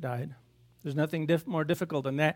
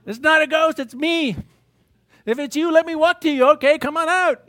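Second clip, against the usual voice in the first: high, louder reassuring call; lightly acted Jesus; 8 semitones higher, 6 dB louder than usual. Jesus reassuring frightened disciples, high and loud. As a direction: call out over the water, easygoing. Jesus answers and Peter replies.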